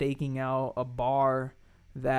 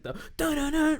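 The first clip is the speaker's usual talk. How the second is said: high sung-out dramatic sting; suspense music imitation; 11 semitones higher than usual. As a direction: mimic a reality-show suspense sting. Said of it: "the suspenseful music".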